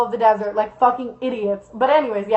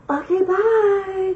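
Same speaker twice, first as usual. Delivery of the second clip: high, stretched-out farewell; imitated weird girl; 7 semitones higher, 3 dB quieter, much slower than usual. Weird girl gives up and walks away, high drawn-out voice. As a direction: drag out a high put-on goodbye. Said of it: "And she's like".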